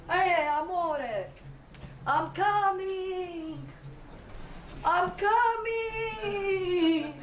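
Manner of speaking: high, long-drawn operatic Italian climax cry; Italian orgasm. Acted. Acting an Italian lover in bed, hammed-up climax. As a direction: stretch each cry out, slow and soaring. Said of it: "So Italian orgasm is like this".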